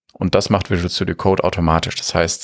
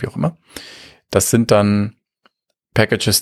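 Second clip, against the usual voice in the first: usual speaking voice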